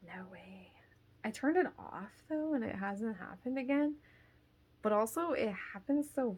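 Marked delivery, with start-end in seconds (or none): 0.1-0.7 flat hushed whisper; whispered refusal. Real, not acted. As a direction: breathe it out firmly. Refusing to go alone.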